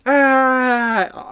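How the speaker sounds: high, loud short mock scream. Reading a silly subtitle aloud, high loud mock scream. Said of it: "Like someone screaming".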